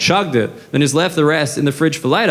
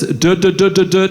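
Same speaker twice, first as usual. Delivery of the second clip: stuttering the syllable du- over and over, a light mockery; 3 semitones higher than usual. Shown as a demonstration. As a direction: stumble on du- repeatedly like a kid stuck on a word. Mocks stutter after naming it.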